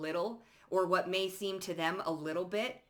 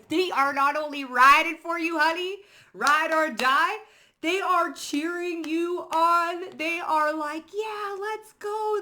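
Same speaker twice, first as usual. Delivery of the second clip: much higher and louder, hyping everyone up; hyped; 10 semitones higher, 8 dB louder than usual. Real, not acted. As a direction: cheer them on with laughing glee. Hyping up the divine support.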